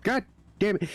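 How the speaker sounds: acted-out frustration; retold frustration. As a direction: imitate a gamer cursing after dying. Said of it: "he was getting frustrated".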